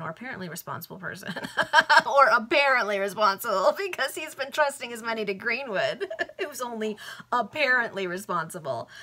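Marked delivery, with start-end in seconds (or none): 1.3-8.9 louder, higher voice breaking into laughter; real laughing aside. Real, not acted. Reader amused at Greenwood being trusted with money, laughing. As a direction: crack up while repeating the words.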